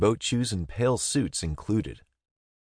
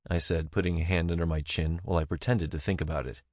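ordinary delivery, nothing marked

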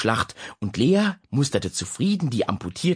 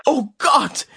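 alarmed gasp; 5 dB louder than usual. Acted. Mother hears alarming news.

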